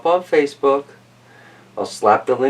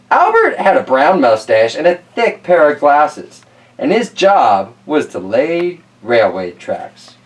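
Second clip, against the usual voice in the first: lightly lifted, louder reading-aloud voice; storybook reading; 4 dB louder than usual. Acted. Reading Tracks to kids, storybook voice. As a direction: read it out to the children. Reads picture book aloud.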